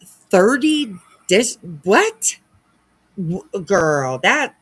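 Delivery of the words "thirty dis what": high, loud shocked what; real shock at the number; stunned disbelief. Real, not acted. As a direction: gasp it out in disbelief. Shocked at thirty jurisdictions.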